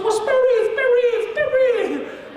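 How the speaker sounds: put-on very high, jubilant Chinese-accented shriek; hammed-up Chinese examiner, thrilled; thick Chinese accent. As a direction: cry out in an exaggerated Chinese accent. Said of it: "she had a really thick Chinese accent".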